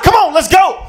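high, loud hurried urging; scared hurry. Retold or imitated. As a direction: rush her out in a panic. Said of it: "I grabbed my girl and said".

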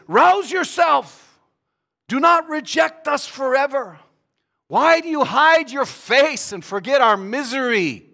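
high, loud, drawn-out protest; crying out to God. Acted. David challenging God in the Psalms, loud anguished plea. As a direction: shout the plea up to heaven. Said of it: "Because David talks like this".

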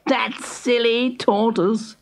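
high, slow scornful hare; smug hare. Acted. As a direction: muse smugly to himself. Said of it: "thought Hare".

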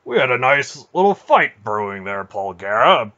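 loud, put-on grumbling complaint; complaining. A narrator's reading. Bear objecting to a spoiled fight, loud complaint. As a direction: grumble it loudly, in character. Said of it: "Bear complained".

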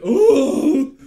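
high, loud "Ooh" as the liquor hits; hammed-up high squeal; startled ooh. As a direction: yelp as the burn kicks in. Mimicking the drink hitting.

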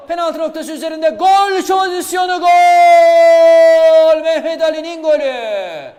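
goal call rising to a huge roar; screaming football commentator. Real, not acted. (heyecanla bağıran spiker)

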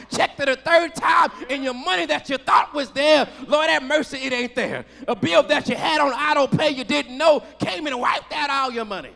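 sermon delivery building in intensity; impassioned preaching. Real, not acted. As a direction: preach it with rising force, punching key words. Preaches at raised pitch and volume.

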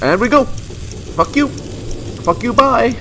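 gleeful taunting. Real, not acted. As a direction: taunt them as you escape. Taunting enemies as bomb blows.

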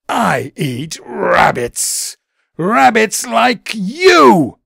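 slow, loud, snarling threat; menacing weasel roar. A narrator's reading. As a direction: snarl it out slowly to scare him. Weasel's reveal, pouncing.

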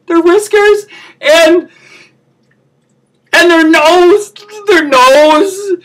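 high, wailing sobs building as you go; hammed-up hysterical weeping; overwrought crying. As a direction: break down completely, squeaking through the tears. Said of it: "I promised myself I wouldn't cry".

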